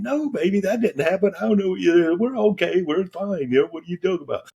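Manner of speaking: calm her down, a bit flustered; reassuring. Retold or imitated. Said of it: "I'm saying".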